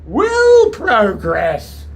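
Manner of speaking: a lightly put-on American accent; a Yankee gent in a stovepipe hat, half-hearted; mild mock American accent; weird Yankee accent. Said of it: "he said in a weird Yankee accent".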